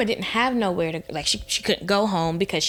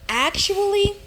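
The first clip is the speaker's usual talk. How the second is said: indignant actually; 11 semitones higher than usual. Retold or imitated. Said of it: "and I'm like".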